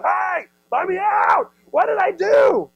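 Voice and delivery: high, slow, loud yell from below; desperate shout. Acted. Joseph shouting up to his brothers, desperate. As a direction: scream up out of the pit for help.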